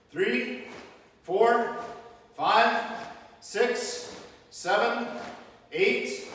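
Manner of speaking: loud, sharp drill counts; counting out loud. Real, not acted. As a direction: bark each count for the punches. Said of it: "We're punching by my count".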